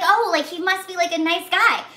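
high, loud, gushing realization; acted naive relief. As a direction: play the girl suddenly won over. Acts out girl being reassured, won over.

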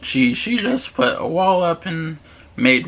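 chewing between words; muffled talking while eating; mouth full of pasta. Real, not acted. Seen 1: Pasta forked in at -3; cheeks full, chewing until about 5.1.

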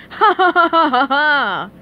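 loud, staccato evil laugh; Plankton's villain laugh. A narrator's reading. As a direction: cackle in hammed-up triumph.

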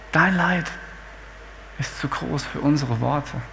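soft and low, sinking at the end; hushed sympathy. Acted. Grieving friends to suffering Hiob, in hushed sympathy. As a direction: speak it gently, as if words barely suffice.

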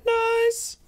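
playful falsetto; squeaky nice. Acted. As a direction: pop the word out in a cartoon falsetto. Silly reaction to game loot.

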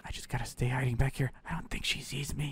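unvoiced hushed whisper. Boy hiding from the demon hunt, whispering. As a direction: whisper so she can't hear.

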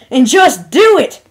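loud, high, drawn-out shouted order; forceful, exasperated yell; angry shout. A narrator's reading. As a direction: shout it with tearful anger. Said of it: "Applejack shouted".

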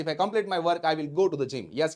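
ordinary delivery

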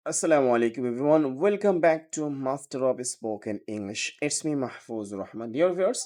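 ordinary talk, nothing marked

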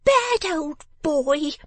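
slow, very high-pitched outraged telling-off; scolding cry. A narrator's reading. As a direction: wail each reproach at a naughty child, drawn out. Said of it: "cried the person of the house".

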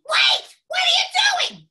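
very high, loud shriek; sudden angry switch. Shown as a demonstration. Teacher showing a character's sudden contrast, high angry shout. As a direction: explode in a high-placed yell. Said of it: "There's that contrast in there".

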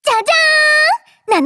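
drawn-out showy ta-da, pitched high; stage-magician ta-da, high and slow; ta-da fanfare. Acted. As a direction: announce the magic trick with a flourish. Magic show announcement.

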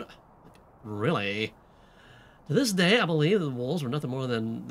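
put-on pretentious teen with lilting upturns and a Southern drawl; swooping teenage drawl; valley girl slipping southern. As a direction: play a valley girl who keeps sliding into Southern. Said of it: "when did the southern drawl enter".

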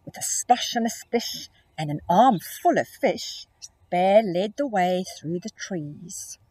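lilting rhymed read-aloud; storybook reading. A narrator's reading. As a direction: read the rhyme to small children. Rhyming story narration.

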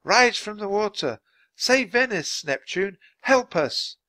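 high, urgent invocation; shouted plea. A narrator's reading. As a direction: call on a sea god for help. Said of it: "shouted Annie".

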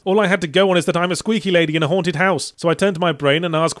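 ordinary talk

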